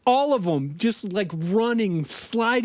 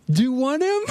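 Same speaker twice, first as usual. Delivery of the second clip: taunting; 6 semitones higher, faster than usual. Retold or imitated. Mike taunting the gang.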